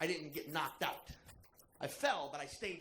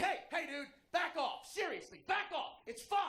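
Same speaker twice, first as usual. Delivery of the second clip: breaking up a fight; 10 semitones higher than usual. Retold or imitated. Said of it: "I'm like".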